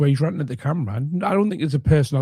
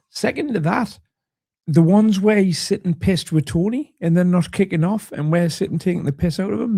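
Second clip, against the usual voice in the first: ordinary delivery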